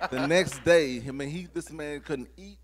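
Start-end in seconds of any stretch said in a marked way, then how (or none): 0.0-1.0 laughing. Real, not acted. Laughing while retelling recovery.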